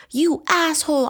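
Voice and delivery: a betrayed woman screaming at her lover; furious shout. Acted. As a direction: scream it at him in rage. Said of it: "I shouted".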